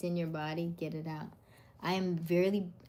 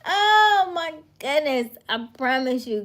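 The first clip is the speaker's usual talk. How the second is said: high, loud delighted outburst; excited exclaim; 9 semitones higher, 14 dB louder than usual. Real, not acted. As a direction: gush with laughter. Still laughing excited.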